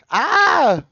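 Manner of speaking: sudden fan squeal; excited squeal. Real, not acted. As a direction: yelp at the good part. Hype moment in song.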